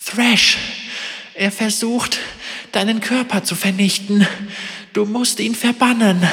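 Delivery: high, urgent spirit voice; an eerie ghost warning urgently; ghostly apparition. A narrator's reading.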